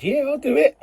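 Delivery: high, loud mock eagerness; volunteer in an exaggerated high, loud voice; eager volunteering. Imagined eager lie to boss.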